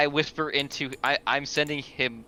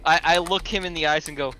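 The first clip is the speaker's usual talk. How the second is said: ordinary talk, nothing marked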